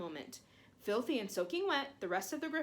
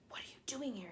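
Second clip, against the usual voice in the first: hushed; 3 semitones higher, 6 dB quieter than usual. A narrator's reading. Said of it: "he said".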